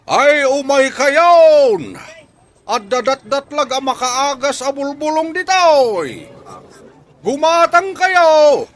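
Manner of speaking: high, loud, drawn-out sales cry to the crowd; a market barker bellowing to draw buyers; street vendor calling out. Acted. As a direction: hawk the herbs at full voice, stretching each phrase. Hawking herbal medicine to passersby.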